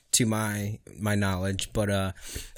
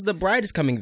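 mock scream; 8 semitones higher than usual. Defending himself to his teasing co-host, mock scream. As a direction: imitate a wedding announcement being screamed. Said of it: "who screams".

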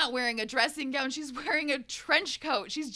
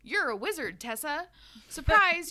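normal talking voice

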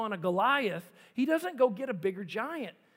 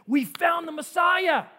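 excited announcement; 6 semitones higher, 7 dB louder than usual. Acted. Philip announces news to friend.

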